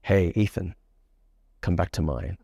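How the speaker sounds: low, unhurried pickup line with sexual energy; seductive. Shown as a demonstration. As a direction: say it low and suggestive. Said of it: "You feel that sexual energy".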